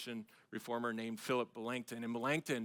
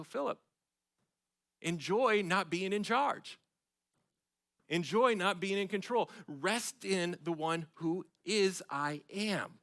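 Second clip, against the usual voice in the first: higher, louder, slow pleading sermon; preaching at full force; 7 semitones higher, 5 dB louder, slower than usual. Acted. Preacher urging his congregation to rest, slow impassioned plea. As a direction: urge them to rest, slowly.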